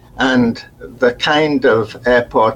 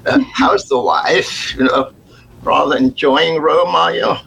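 imitating posh boss; 6 semitones higher than usual. Retold posh boss chatting.

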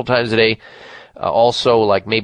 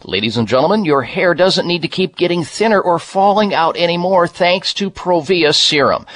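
chipper 1950s ad; 5 semitones higher than usual. Acted. Reads Provia ad on air.

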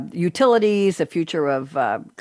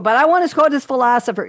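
mock whiny plea; 7 semitones higher, 5 dB louder than usual. Mimics listeners pleading to use quotes.

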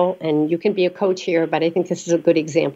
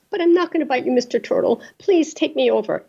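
coaxing snake; 7 semitones higher than usual. Acted. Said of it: "keeps coaxing the turtle".

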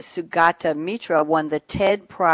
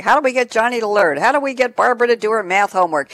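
quick, raised-pitch mock parent plea; imitating fretting parents; 5 semitones higher, 5 dB louder, faster than usual. Host quoting parents and teachers to her guest, mock fretting. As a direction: lightly voice parents and teachers asking, louder and faster. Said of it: "parents and teachers always say".

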